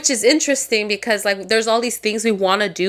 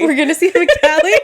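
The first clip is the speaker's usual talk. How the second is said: fast and laughing, louder; 7 semitones higher, 6 dB louder, much faster than usual. Real, not acted. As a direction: giggle through the words. Echoes friend while laughing.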